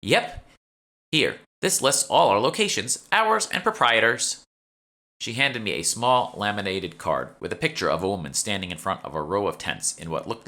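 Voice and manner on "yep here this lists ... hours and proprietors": a touch higher, chatty shop clerk; lightly voiced shopkeeper. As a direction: play the eager young shopkeeper, slightly raised. Nikki hands over card.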